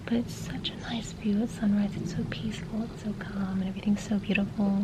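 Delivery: soft hushed whisper; library whisper. Real, not acted. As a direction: whisper to keep it quiet. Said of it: "I'm whispering because there are people".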